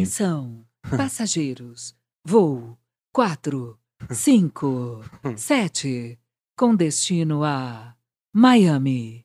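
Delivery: low, slow airport announcer voice; deep and drawn out over the PA; airport announcement. Retold or imitated. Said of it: "voz de aeroporto". (anúncio de aeroporto grave e lento)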